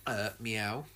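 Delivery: soft, low mock Sonic meow; lightly voiced cartoon hedgehog meowing. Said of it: "that's what Sonic says in the trailers".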